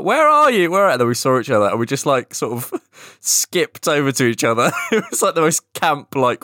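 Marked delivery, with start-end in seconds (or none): 0.0-0.9 high, loud searching call; calling out on the phone. Retold or imitated. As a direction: shout into the phone to find a friend. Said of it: "we were on the phone like".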